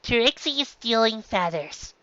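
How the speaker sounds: slow, deliberately even; forced calm. A narrator's reading. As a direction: say it as calmly as you can. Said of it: "said calmly as possible".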